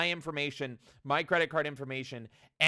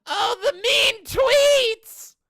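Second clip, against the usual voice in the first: much higher, louder sarcastic whine; hammed-up mock outrage; sarcastic mock gasp; 11 semitones higher, 7 dB louder, slower than usual. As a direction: gasp in fake horror. Mocking the company.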